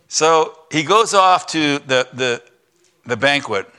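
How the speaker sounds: ordinary talk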